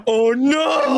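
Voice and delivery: high, loud oh no; real high-pitched dismay; dismayed cry. Real, not acted. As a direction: cry out at the bad roll. Player reacts to fatal roll.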